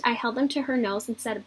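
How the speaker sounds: usual speaking voice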